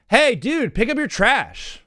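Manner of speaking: calling out litterers. Retold or imitated. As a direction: yell across the lot at the litterers. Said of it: "I was like".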